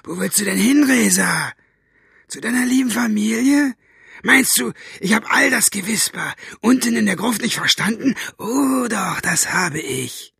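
high-pitched menacing villain voice; sneering, threatening high voice; Buster, hissing villain. A narrator's reading. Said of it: "zischte Buster".

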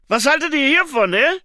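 loud, high, intrusive shout; pushy visitor. Acted. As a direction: burst in loudly, showing off your find. (laut und hoch dazwischenplatzend)

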